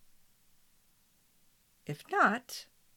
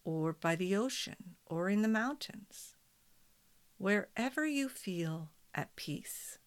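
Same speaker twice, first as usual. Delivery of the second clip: guided meditation; 5 dB quieter than usual. Acted. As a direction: guide them gently into calm.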